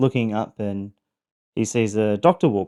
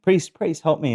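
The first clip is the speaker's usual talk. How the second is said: higher-pitched mock plea; calling for help; 7 semitones higher than usual. Trapped man calling to a passing priest, mock plea for help. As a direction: call up to a passerby for help, lightly acted.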